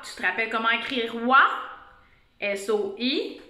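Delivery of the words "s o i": normal talking voice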